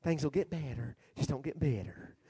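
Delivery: hushed, low preacher impression; Joel Osteen. Quoting the preacher's slogan, very soft mock soothing voice. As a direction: imitate a softly soothing TV preacher. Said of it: "I think Joel Osteen said that".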